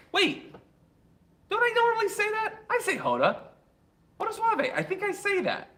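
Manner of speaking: high-pitched mock protest; mock defensive. Host questioning a viewer's correction of a name, mock defensive.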